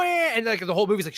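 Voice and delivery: ordinary talk, nothing marked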